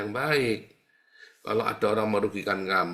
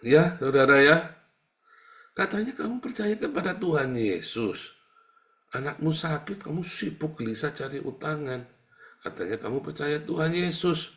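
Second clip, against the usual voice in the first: louder and higher, challenging the congregation; loud rebuke; 6 semitones higher, 4 dB louder than usual. Real, not acted. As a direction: call them out as a preacher. Preacher resumes loud rebuke. (teguran lantang)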